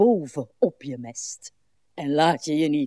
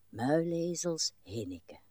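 whispered aside; 4 semitones lower, 9 dB quieter than usual. Acted. Aside to herself.

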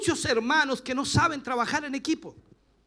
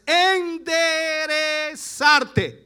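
loud, syllables stretched very long; slow, forceful stretch on every syllable; drawn-out emphasis; 4 semitones higher, 7 dB louder, much slower than usual. Real, not acted. Preacher to congregation on what God wants, drawn-out emphasis. Preacher hammers his key word.

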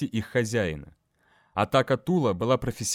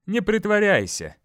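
high, loud and sharp; angry; 8 semitones higher, 4 dB louder than usual. A narrator's reading. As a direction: snap it out in anger. Angry accusation.

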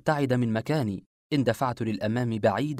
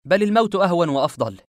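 loud, high, emphatic rejection; defiant refusal; 6 semitones higher, 8 dB louder than usual. A narrator's reading. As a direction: throw the offer back forcefully. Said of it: "رفضت إجابته قائلا". (برفض قاطع وصوت عالٍ)

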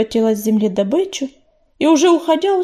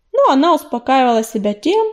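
usual speaking voice

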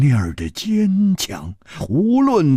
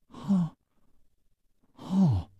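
soft sigh; 3 semitones higher, 8 dB quieter than usual. Acted. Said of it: "轻轻地叹息了一声".

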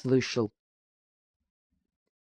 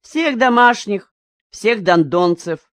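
scolding wife; 5 semitones higher, 4 dB louder, slower than usual. A narrator's reading.

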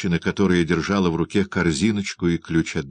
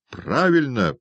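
higher cry of approval; calling out; 6 semitones higher, slower than usual. A narrator's reading. Onlooker calling from an attic window, approving. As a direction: call it down from a window. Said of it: "крикнул из окна на чердаке".

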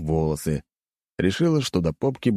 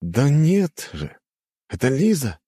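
surprised; 8 semitones higher, slower than usual. A narrator's reading. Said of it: "с удивлением".

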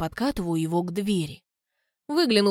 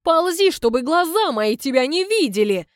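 higher, louder furious order; angry; 6 semitones higher, 6 dB louder than usual. Acted. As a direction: spit it out in disgust. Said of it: "со злостью".

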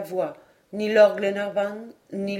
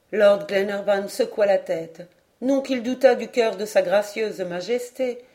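usual speaking voice

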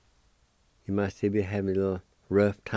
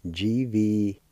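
letters spelled out slowly; slow, careful letter spelling; much slower than usual. Shown as a demonstration. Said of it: "And it's spelled this".

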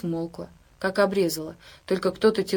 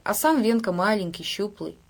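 ordinary delivery, nothing marked

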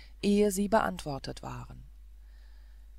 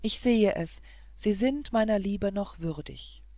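usual speaking voice

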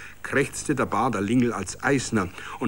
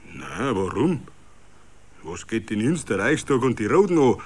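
composed annoyance. A narrator's reading. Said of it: "gefasst und verärgert".

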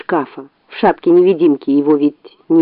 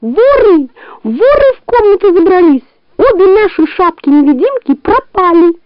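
fox screaming; 11 semitones higher than usual. A narrator's reading. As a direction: scream the alarm. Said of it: "А лиса как закричит".

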